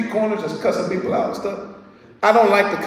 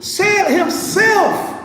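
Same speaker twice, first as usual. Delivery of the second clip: preaching at full force; 8 semitones higher than usual. Acted.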